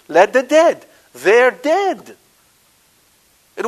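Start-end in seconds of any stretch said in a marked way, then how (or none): 0.1-2.2 louder and higher, hammering it home; forceful quote. Shown as a demonstration. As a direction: insist on it with force. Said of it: "Jesus just spoke the truth".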